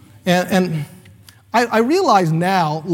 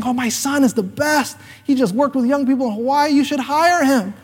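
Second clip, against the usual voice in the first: higher gushing mom, slowing on the last line; proud mom; 7 semitones higher than usual. Retold or imitated. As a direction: brag about her son like a proud mother. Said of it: "she said the, the whole thing".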